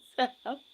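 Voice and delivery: short loud laugh at herself; loud burst of laughter. Real, not acted. Guest laughs at admitting she is hard to please, loud laugh. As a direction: laugh out at her own joke.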